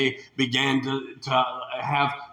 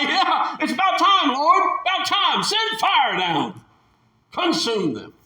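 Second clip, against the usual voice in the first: fed-up shout; 12 semitones higher than usual. Pretend. Preacher pretending to be Moses demanding fire, shouting. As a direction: call down fire in mock exasperation.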